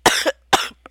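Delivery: hard dry coughs; cough, choking on the words; coughing fit. Real, not acted. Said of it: "I'm choking on the words".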